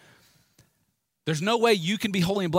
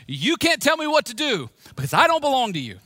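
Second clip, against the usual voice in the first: higher and louder, defiant declaration; aggressive defiance; 7 semitones higher, 5 dB louder than usual. Shown as a demonstration. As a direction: tell Satan off with force. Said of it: "sometimes you just gotta get aggressive".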